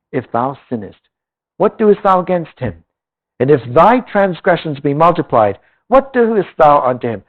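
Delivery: measured, solemn Bible reading; scripture reading. Acted. Preacher reading Job to the congregation, solemn scripture voice. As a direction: read the old words with weight. Reads Job aloud in sermon.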